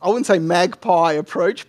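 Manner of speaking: usual speaking voice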